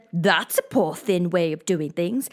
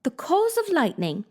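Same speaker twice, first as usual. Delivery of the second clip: decided; 9 semitones higher than usual. Acted. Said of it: "Alice said very decidedly".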